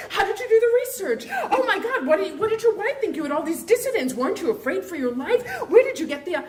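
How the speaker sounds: high, laughing barrage of questions; excited fan pestering the author on the tarmac; gushing fan. Retold or imitated. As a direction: pepper him with starstruck questions.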